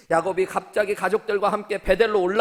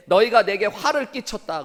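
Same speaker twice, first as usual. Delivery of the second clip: raised, higher voice for a father's rebuke; preacher acting out Jacob's outburst; retold angry shout; 5 semitones higher, 6 dB louder than usual. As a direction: call out the accusation loudly, as a retelling. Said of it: "소리를 질러요".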